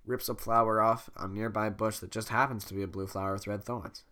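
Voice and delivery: ordinary delivery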